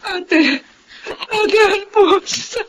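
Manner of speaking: crying out to her sister, voice breaking; high, slow, tearful calling. Acted. Celeste tearfully calls to her older sister. Reunion with long-lost sister.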